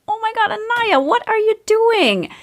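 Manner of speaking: mother startled when her daughter kicks the laptop off the couch; startled exclamation. Retold or imitated. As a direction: react as the laptop hits the floor. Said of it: "I was like".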